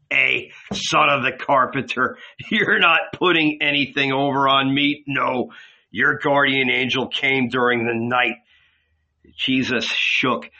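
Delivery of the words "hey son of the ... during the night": ordinary delivery, nothing marked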